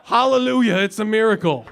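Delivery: over-the-top church praise, laughing through the end; mock hallelujah shout. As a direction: shout it like a revival preacher mocking the miracle.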